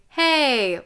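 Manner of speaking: firm spoken chest voice; speaking voice. Shown as a demonstration. Coach showing students chest voice, spoken in speaking voice. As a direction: say it in full chest voice, falling at the end. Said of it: "Chest voice or speaking voice".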